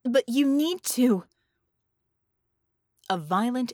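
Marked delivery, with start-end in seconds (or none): 0.0-1.2 raised, slow urgent call; urgent shout. A narrator's reading. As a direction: push the words out urgently. Cut off by an explosion.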